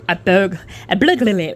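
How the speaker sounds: tongue-tied attempt at burglary; stumbling burglary. Real, not acted. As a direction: trip over burglary, it comes out blurglary. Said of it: "now I can't say it".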